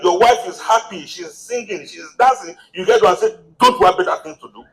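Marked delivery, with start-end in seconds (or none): 3.6-4.6 high, quick mock scolding; fast, high-pitched acted irritation; grumpy rebuke. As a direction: play the unpleasant husband telling off his wife. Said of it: "Then you are not pleasant".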